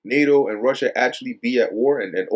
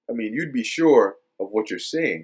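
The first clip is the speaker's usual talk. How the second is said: normal talking voice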